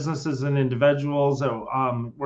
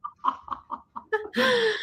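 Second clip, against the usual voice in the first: high laugh; 5 dB quieter than usual. Real, not acted. Laughing at own joke.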